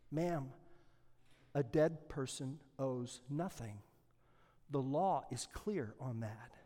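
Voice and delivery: calm and final, almost hushed; quiet, firm judge. Acted. Judge quietly telling the mother the law is clear. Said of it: "slowly said".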